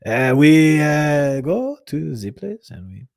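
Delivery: lightly put-on French accent; halting speech in a mild French accent. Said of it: "your Hani impression".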